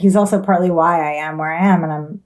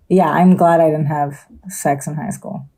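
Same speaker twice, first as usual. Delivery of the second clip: ordinary delivery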